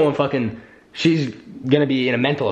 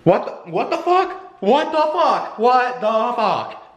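exasperated disbelief; 8 semitones higher, slower than usual. Real, not acted. Disbelief after the fight.

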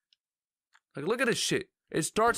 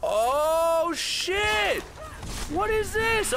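shocked outburst; 10 semitones higher, much slower than usual. Real, not acted. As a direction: caught off guard, voice jumps up. Sudden new boss mechanic.

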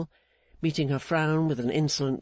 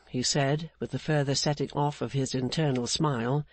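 ordinary delivery

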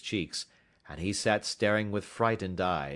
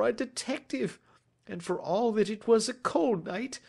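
higher-pitched voice of an anxious culprit; confessing culprit; 14 semitones higher, 4 dB louder than usual. Acted. Culprit recounts his theft to the detective, anxiously confessing. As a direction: confess it all, uneasy and earnest.

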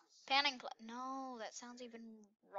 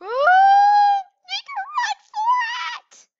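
high, loud burst of a yell; screaming to make a run for it; excited shout; 18 dB louder, slower than usual. Real, not acted. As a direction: scream it as you bolt. Dashing for it in the game.

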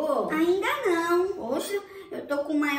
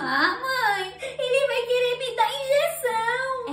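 put-on whiny crying kid; 7 semitones higher, slower than usual. Kid begging not to go.

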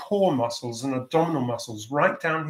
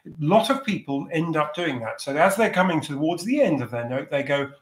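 ordinary delivery

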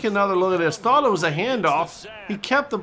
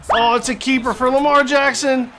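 climbs higher and louder toward a near scream; building excitement; 8 semitones higher, 5 dB louder than usual. Acted. Commentator calling Lamar's touchdown run, building to a shout.

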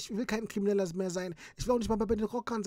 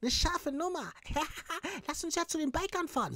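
eager nephew; 8 semitones higher than usual. Acted. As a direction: chirp agreement like a fan. Nephew figure voice.

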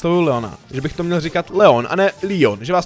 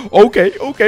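gasping, high OK OK; startled gasp; 7 semitones higher, 5 dB louder than usual. Real, not acted. Nearly dying, he gasps out a startled OK. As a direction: caught off guard, gasp then blurt.

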